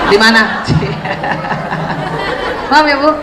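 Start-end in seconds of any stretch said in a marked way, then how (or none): 0.7-2.7 soft, held-in chuckle; stifled laugh. Real, not acted. Teacher laughing quietly at her own joke about the photo, stifled. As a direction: laugh under the breath.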